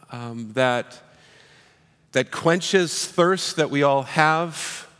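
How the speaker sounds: soft, low, unhurried prayer voice. Acted. Pastor opening the sermon with prayer, soft and reverent. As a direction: pray quietly and reverently to the Father.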